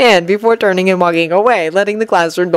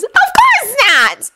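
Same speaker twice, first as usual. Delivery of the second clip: scoffing; slower than usual. Acted. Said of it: "Hermione scoffed".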